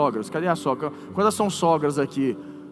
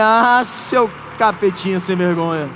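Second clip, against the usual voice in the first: slow, drawn-out playful telling-off; mock scolding; slower than usual. Preacher tells off the little devil, mock scolding. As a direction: stretch out the 'Ah', then scold in jest.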